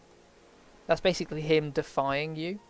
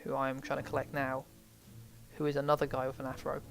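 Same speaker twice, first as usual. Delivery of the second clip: usual speaking voice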